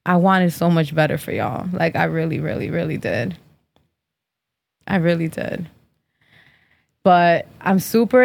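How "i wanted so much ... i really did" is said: disappointed. Real, not acted. Mock disappointment in followers.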